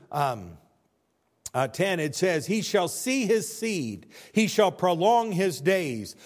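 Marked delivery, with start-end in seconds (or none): 2.5-6.2 high, loud, slow proclaimed verse; preacher booming the verse to the church; loud scripture reading. Acted.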